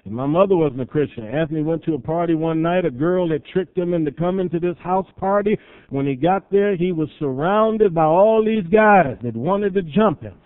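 ordinary talk

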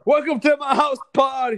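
high, loud party host; announcer voice. Acted. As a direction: welcome them like a hype host.

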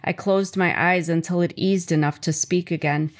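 ordinary delivery

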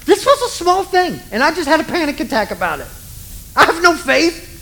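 high, fast-rising panicky self-talk; mock panic. Preacher imitating himself panicking over a small thing. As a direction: play your own panic, half-joking. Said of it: "And I'm like".

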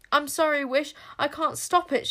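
high, loud, anguished apology; desperate shout. Acted. Boy shouting sorry to Wish as the sword falls. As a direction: shout it as the hand drags him. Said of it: "shouted Zara".

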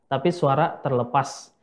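normal talking voice

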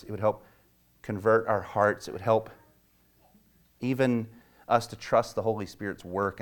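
soft, low prayer voice. Acted. Pastor praying before the sermon, hushed and earnest. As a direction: pray quietly and earnestly to God.

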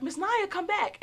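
high call after her; girls calling. Retold or imitated. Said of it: "they were like".

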